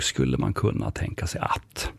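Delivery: hesitant whispered proposal; tentative whisper. Shown as a demonstration. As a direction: float the idea under your breath. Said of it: "du får gå lugnt och fint tillväga".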